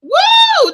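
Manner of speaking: high, loud whoop of excitement; real hype shout after a rousing speech; excited whoop. Real, not acted. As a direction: let out a thrilled woo. Reacting to the speech clip.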